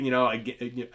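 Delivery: normal talking voice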